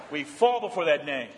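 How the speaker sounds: ordinary talk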